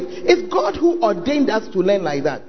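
usual speaking voice

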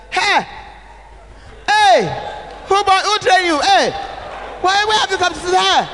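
high, loud scolding shouts of Hey; rude shouting. Shown as a demonstration. Bishop's wife rudely yelling at a guest in the chair. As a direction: bark at the guest to get out of the chair. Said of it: "I won't say it in a way that".

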